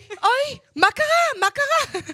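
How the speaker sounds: high, slow, put-on worried voice; mock worried friend. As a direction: imitate the friend's squeaky alarmed question. Mimicking friend's worried question. (חיקוי מודאג וגבוה)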